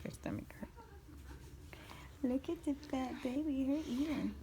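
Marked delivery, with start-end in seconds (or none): none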